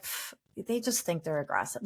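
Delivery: dismissive scoff. Retold or imitated. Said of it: "I'm like".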